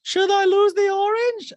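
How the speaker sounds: very high, loud, slow squeal; imitating Diana in an orange outfit; mock Diana. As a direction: squeal it at yourself. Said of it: "And Diana's like".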